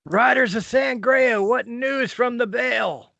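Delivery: high, loud, drawn-out hail; ringing in-character shout; hailing call. Acted. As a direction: call out slowly to distant riders. Player hails passing riders in character.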